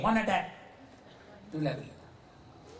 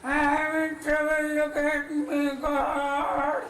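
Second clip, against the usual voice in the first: high, drawn-out plea; pleading to God; 8 semitones higher, slower than usual. Acted. Old man pleading with God in trouble, high and drawn out. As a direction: cry out to God. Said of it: "आप God को बोलेंगे".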